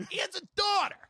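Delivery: high, exasperated yell; storyteller repeating the absurd excuse; exasperated outburst. Retold or imitated. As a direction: blurt it out in disbelief. Exasperated retelling.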